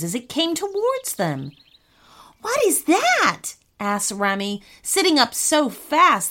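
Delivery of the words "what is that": high-pitched, slow startled question. Acted. Boy woken by crashing asks his brother, startled. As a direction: jolt upward in pitch, drawing it out.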